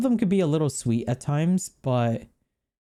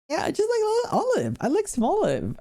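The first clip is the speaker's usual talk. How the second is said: put-on much higher, squeaky baby talk; cute baby voice; faster than usual. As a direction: coo over the little olive like a baby. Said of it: "I don't know why I'm talking like that".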